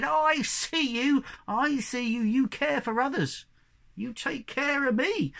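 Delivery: put-on high, stretched-out voice of a magical old fellow; kindly apple tree man. Apple tree man to the caring brother, high solemn promise.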